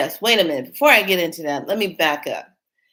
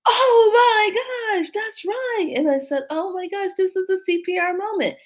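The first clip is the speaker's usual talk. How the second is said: much higher, near-tearful astonishment; overwhelmed realization; 13 semitones higher, 4 dB louder than usual. Retold or imitated. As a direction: cry out as it all clicks into place. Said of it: "I come back and I go".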